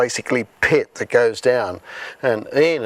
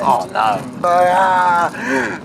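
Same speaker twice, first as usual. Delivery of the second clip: a mock panicked groan rising into a higher cry; alarmed; 6 semitones higher, much slower than usual. Driving the pregnant girl, a replayed alarmed cry. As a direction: relive the moment the labour starts in the car. Labour pains, racing to hospital.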